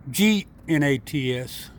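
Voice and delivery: slow, letter-by-letter spelling. Shown as a demonstration. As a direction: spell it out with gaps between letters.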